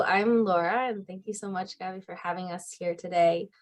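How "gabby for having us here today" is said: normal talking voice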